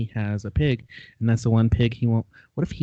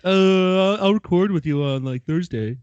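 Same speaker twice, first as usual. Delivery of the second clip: lightly mocking imitation of a colleague; stalling opening, louder and higher, then easing off; Ted; 5 semitones higher, 4 dB louder than usual. Co-host mimics Ted putting off a recording, mock impression. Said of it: "He'd be like".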